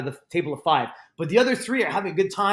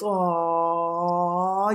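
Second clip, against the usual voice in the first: long, held "ohh" of a crowd; mock collective "ohh"; crowd's groan. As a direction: imitate an audience's drawn-out reaction. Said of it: "everybody goes".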